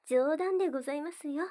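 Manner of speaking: ordinary delivery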